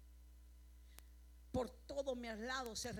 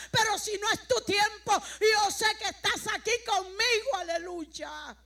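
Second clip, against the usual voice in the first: raised, high-pitched testimony building in volume; fervent preaching; 4 semitones higher, 12 dB louder than usual. Acted. Preacher recalling her prayer near death to the church, fervent preaching. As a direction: preach it loud, drawing out the final hallelujah.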